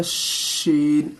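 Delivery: शीन drawn out slowly with a whistling hiss; whistling sheen. Shown as a demonstration. Teacher shows the whistling sound of शीन. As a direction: stretch शीन into a long whistling sh. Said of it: "सीटी की आवाज़ में बोलनी है".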